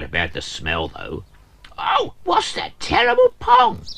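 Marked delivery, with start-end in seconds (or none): 1.8-3.9 very high, louder cry of disgust. Acted. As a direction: recoil from the stink. Character smells fertilizer.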